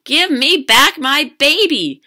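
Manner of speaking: loud, high, slow demand; yelling father. A narrator's reading. Father yelling down the ice hole for his child. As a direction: yell it out word by word. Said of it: "Cheryl's father yelled down the hole".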